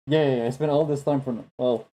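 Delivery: normal talking voice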